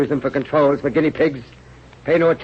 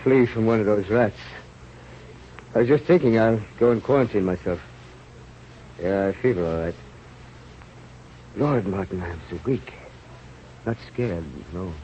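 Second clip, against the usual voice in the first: low, faint, sinking voice; weak with fever; 5 semitones lower, 5 dB quieter than usual. Acted. Plague-struck rat killer telling Martin, low and faint. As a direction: let the strength drain out of every word.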